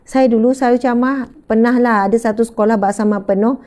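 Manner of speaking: ordinary talk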